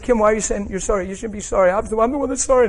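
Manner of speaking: freaked-out pleading, acted out; retold freaked-out protest. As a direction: reenact being thrown, pitch climbing as you object. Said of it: "And I was freaked out".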